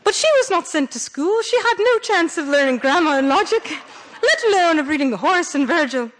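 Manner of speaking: lightly put-on English accent, like bad Downton Abbey; Virginia Woolf. Lecturer reading Woolf to students in a half-hearted posh English accent. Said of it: "I can't do a Virginia Woolf accent, ... But, like, imagine Virginia Woolf".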